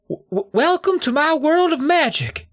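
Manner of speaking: high, stammering squeak; a nervous kid's voice cracking; squeaky nervous voice. Acted. Brady opens his magic act on stage, squeaking with nerves. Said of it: "My voice squeaked a little on the word magic".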